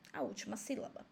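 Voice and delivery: repetição sussurrada, bem baixinho; sussurro. Real, not acted. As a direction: confirme sussurrando, quase sem voz. (sussurrado, como quem confirma baixinho)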